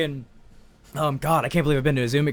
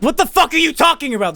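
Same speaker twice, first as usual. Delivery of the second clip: high-pitched indignant question; incredulous outburst; 11 semitones higher, 4 dB louder than usual. Retold or imitated. Con-goer hears about pre-tickets, incredulous and annoyed. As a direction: fire back in disbelief. Retold argument with con staff.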